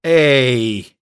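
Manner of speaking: narrated woman's shout. A narrator's reading. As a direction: call out sharply to get attention. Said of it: "she shouts".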